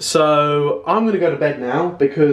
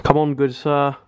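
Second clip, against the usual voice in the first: usual speaking voice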